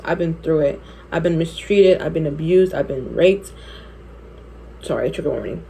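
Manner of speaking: ordinary talk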